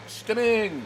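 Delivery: sing-song repeat of stimming; stimming echo. Real, not acted. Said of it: "you can't stop me from emoting".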